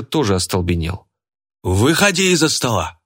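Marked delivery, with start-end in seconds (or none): 1.6-3.0 high, forceful character holler; roared order. A narrator's reading. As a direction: snap out the order in a raised voice. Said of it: "рявкнула Лика". (грозный окрик)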